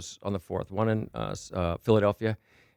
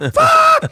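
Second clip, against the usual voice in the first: piercing high scream back; startled scream; 12 dB louder than usual. Retold or imitated. Storyteller screams back at the girl who surprised him, startled scream. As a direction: shriek the swear at full volume, mock terror.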